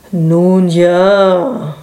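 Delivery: long, stretched-out reluctant words; drawn out. A narrator's reading. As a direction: draw both words right out. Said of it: "sagt Gerald gedehnt".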